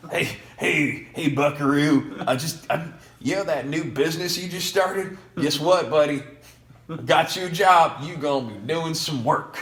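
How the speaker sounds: put-on loud, high-pitched Southern good-old-boy voice; Bob Penny with Southern accent. Major donor phoning his grandson about a job, hammed-up Southern accent. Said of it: "why Bob Penny speaks with a Southern accent".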